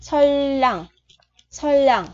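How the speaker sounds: "Soi lẳng" as sol-lang, long held l, falling end; double-l pronunciation demo. Shown as a demonstration. Teacher demonstrates two R becoming L, held-l demo. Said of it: "chữ phía sau mình đọc là L".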